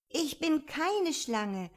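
slow, indignant denial with keine leaned on; protest, offended; a girl's indignant insistence. A narrator's reading. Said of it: "sagte Alice mit Entrüstung".

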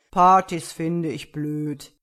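slow, loud bear voice; grumpy bear. A narrator's reading. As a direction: grumble it slowly as a big bear. Bear declines the party.

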